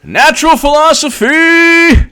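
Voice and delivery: drawn-out high voice. Retold or imitated. Reading a username.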